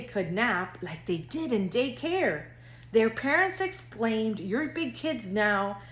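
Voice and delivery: lively singsong storybook reading; reading to children. A narrator's reading. Counselor reading to future kindergartners, bouncy storybook narration. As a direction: read it like bedtime story time for little kids. Reads picture book to kindergartners.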